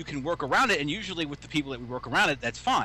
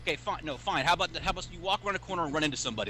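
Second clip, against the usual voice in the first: ordinary talk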